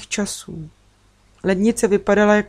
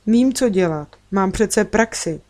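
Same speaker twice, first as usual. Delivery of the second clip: plain reading voice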